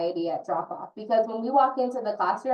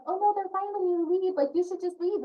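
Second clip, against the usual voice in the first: breezy dismissive teacher; 10 semitones higher than usual. Retold or imitated. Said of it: "I'm like".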